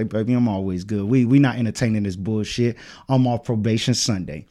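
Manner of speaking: low, soft and unbothered; unbothered reassurance. Retold or imitated. Telling a homie he's always good, quietly unbothered. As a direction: keep it low and quiet, brushing it off.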